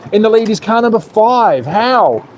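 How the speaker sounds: high-pitched, incredulous race call; live race commentary. Real, not acted. Commentator calling the new race leader, incredulous play-by-play.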